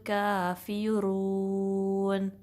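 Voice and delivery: slow, melodic tajweed recitation; Quran recitation. Shown as a demonstration. Teacher reciting the verse for her students, slow and melodic. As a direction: chant each word out slowly, stretching the vowels. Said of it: "verse number टू में".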